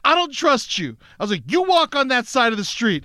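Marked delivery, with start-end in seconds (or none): 0.0-0.9 high-pitched, insistent refusal; mock confrontational accusation; retold distrust. Man retelling his words to a follower, high and distrustful. 1.5-3.0 higher, insistent command; mock sending someone off; bossy retold order. Man retelling his order to a creeping stranger, high and bossy.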